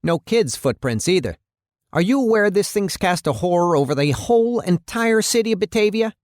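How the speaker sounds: high-pitched, agitated mayor dressing someone down; angry mayor. Acted. Mayor berating his police chief over the case, angry.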